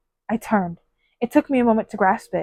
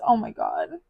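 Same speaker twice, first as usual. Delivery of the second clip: usual speaking voice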